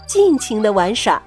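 usual speaking voice

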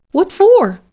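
high, loud shocked question. A narrator's reading. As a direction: gasp it out in disbelief. Shocked pig asks why.

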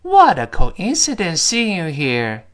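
slow, clear line for repeating; slow model reading. Shown as a demonstration. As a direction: read it slowly for students. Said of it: "跟老师完整的再读一遍". (慢速示范朗读)

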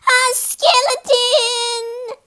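high, drawn-out wail of fright; horrified cry. Acted. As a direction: wail it out, very high and held long. Great aunt's dreadful sight, acted out.

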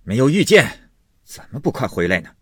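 loud, high outburst that drops away; angry yelling. Acted. Husband who missed his man retorting to his wife, yelling. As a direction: lash out on the first words. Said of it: "贾琏又嚷道".

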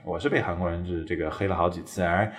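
ordinary talk, nothing marked

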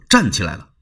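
plain reading voice

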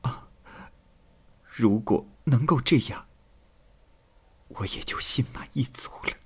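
soft, whispered acceptance after tears; just stopped crying. A narrator's reading. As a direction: give in quietly, almost all breath. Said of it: "庚娘止住哭泣说". (止住哭泣，轻声细语地说)

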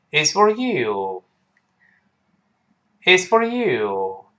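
slow recital of "It's for you", YOU full; stress on YOU. Shown as a demonstration. Teacher leading learners in recital, slow with YOU stressed. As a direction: keep "you" long and unweakened. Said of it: "注意，you有重读".

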